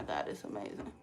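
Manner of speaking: whispered in awe; breathless whisper. Real, not acted. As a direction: whisper it like it's too good to say. Raving about her egg drop soup.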